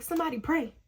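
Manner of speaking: high-pitched mock yell; imitating a cry for help; retold scream. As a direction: plead it high, hamming up the panic. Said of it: "screaming at them".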